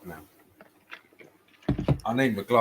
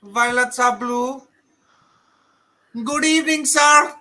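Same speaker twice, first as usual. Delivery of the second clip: high, slow mock Habib accent; 13 semitones higher, 3 dB louder than usual. Gamer reciting a joke verse to the chat, Habib accent. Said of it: "what Gamer was saying with the Habib accent".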